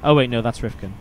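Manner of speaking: usual speaking voice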